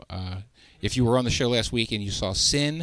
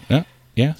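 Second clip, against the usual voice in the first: ordinary talk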